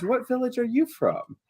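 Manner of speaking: high, soft curious wife; villager wife's question. Acted. Wife's question, redone in character.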